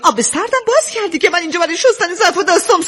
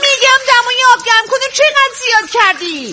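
repeating to be heard; 6 semitones higher than usual. Acted. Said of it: "چی میگی؟". (تکرار بلند و کشیده برای کسی که نشنیده)

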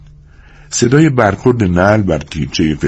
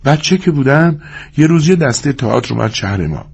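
mildly eager, higher-pitched woman's voice; eager and hurried; 8 semitones higher than usual. A narrator's reading. Woman telling her stage dream to Lennie, eager. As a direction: pour out the story with some eagerness. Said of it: "با شوقی زیاد با عجله گفت".

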